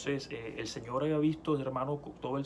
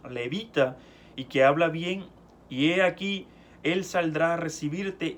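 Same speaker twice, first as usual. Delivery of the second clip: preaching at full force; 3 semitones higher, 6 dB louder than usual. Acted. Preacher reading Exodus to the congregation, full-force preaching. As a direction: proclaim the verses as God's rebuke. Preacher reads God and Moses aloud.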